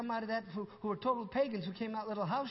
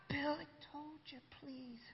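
much softer, pleading hush; hushed plea; 7 semitones higher, 10 dB quieter, slower than usual. Retold or imitated. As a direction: hush him urgently.

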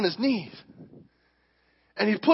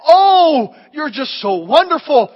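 mock gushing praise; 5 semitones higher, 8 dB louder, slower than usual. Mocking society's flattery of kids.

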